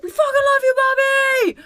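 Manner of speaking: high, loud fan scream; whole audience screaming their love for Barbie; crowd cheer. Retold or imitated. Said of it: "And everyone's like".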